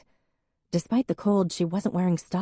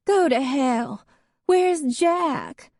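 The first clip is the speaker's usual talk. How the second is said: put-on high, louder drunken snap; drunk outburst; 10 semitones higher, 4 dB louder, much slower than usual. A narrator's reading. Drunk ex-wife cursing at Em, high and loud. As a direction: lash out, then demand Jack.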